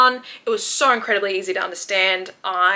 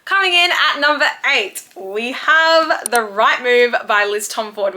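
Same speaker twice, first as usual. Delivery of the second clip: countdown announcer; 3 semitones higher, 5 dB louder than usual. Acted. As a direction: present the next pick with flair. Countdown list entry announced.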